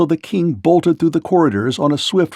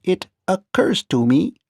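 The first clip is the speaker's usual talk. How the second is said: higher, even clockwork calm; lightly voiced calm machine; Tik-Tok calmly scolds the rolling king; 6 semitones higher than usual. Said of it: "said Tik-Tok calmly".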